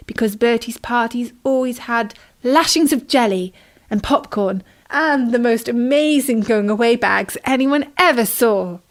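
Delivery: ordinary delivery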